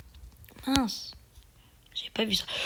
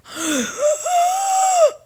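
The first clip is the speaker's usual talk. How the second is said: high cry of shock; shocked gasp cry. Real, not acted. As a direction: gasp out in dismay.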